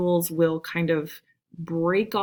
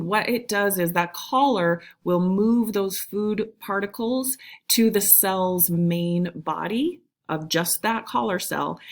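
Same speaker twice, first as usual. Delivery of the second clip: usual speaking voice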